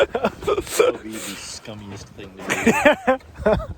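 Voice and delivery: slow, high and loud through laughter; laughing while talking. Real, not acted. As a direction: talk through a big laugh. Laughing at the joke.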